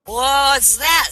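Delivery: put-on loud, high-pitched drunk. Drunk asking the bartender about a prize, loud drunk voice. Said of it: "says the drunk".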